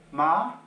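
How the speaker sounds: MA climbing high and loud; rising tone. Shown as a demonstration. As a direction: push MA steadily upward, strong and clear. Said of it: "up, up, up going accent".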